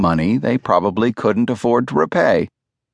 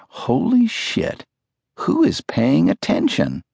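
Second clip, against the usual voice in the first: higher, slow and hushed gasp of shock; stunned disbelief; 6 semitones higher than usual. Retold or imitated. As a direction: let the shock slip out before the question. Stunned thought after the meeting.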